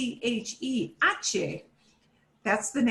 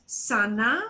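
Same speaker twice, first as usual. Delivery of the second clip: "Sana" on its own with a rising end; isolated "Sana", left hanging upward; first part alone. Shown as a demonstration. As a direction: say "Sana" as an unfinished half word, lifting at the end. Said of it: "Split it into two parts".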